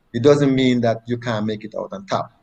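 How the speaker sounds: ordinary delivery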